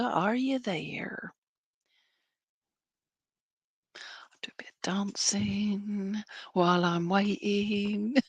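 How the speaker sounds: soft breathy whisper, slow. Real, not acted. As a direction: whisper it slowly. Calling spirit, then waiting quietly.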